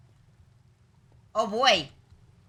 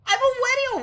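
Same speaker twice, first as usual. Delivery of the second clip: very high, squeaky, giggly protest; shrill-pitched comic outburst; squealing laughter; 14 semitones higher, 6 dB louder than usual. Acted. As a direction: burst out laughing into a helium squeak.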